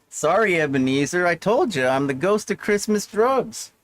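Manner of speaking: ordinary talk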